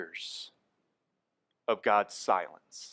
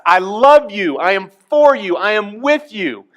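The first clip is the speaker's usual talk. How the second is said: God's loving shout; 9 semitones higher, 12 dB louder than usual. Pretend. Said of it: "God's way of screaming at us".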